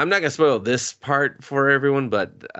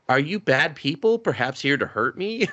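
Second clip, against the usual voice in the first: lightly voiced odd mother, pitched up; a hint of a strange mom's higher voice; oddball mom; 5 semitones higher than usual. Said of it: "who acts the same way".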